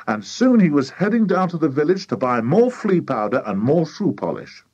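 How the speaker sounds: ordinary delivery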